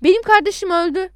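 high, loud acted protest; indignant shout. A narrator's reading. Adnan insisting to the caller his brother is dead, shouted. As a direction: protest forcefully, raising pitch and volume. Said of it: "diye bağırdım".

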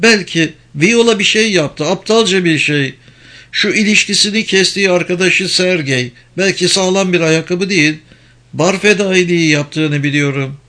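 high-pitched woman's role; Penelope, speculating. Acted. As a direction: lift the pitch, working out a theory.

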